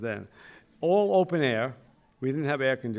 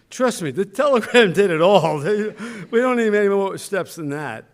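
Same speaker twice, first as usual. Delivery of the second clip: laughing; 6 semitones higher, 10 dB louder than usual. Real, not acted. Laughing while telling the telegram.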